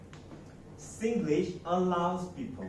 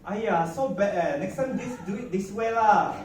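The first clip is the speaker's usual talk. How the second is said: louder, higher Singlish phrasing; 6 semitones higher, 7 dB louder than usual. Shown as a demonstration. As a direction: say it the Singlish way with lah. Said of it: "But in Singlish".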